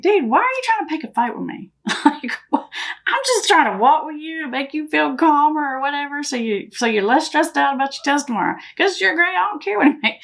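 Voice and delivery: high-pitched, retold exasperation; exasperated retort. Mom asks son why he's picking a fight, exasperated. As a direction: protest to a kid who keeps needling you.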